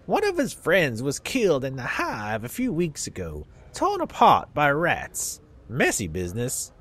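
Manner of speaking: put-on southern accent. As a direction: play a crusty old Southern dustman in a Southern accent. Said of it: "give old people southern accents".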